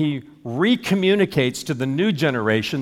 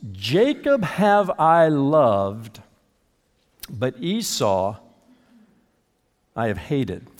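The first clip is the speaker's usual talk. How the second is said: slow, weighty, falling on hated; slow grave recitation; solemn scripture; much slower than usual. Acted. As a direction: lay each word down slowly and gravely.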